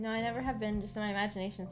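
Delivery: normal talking voice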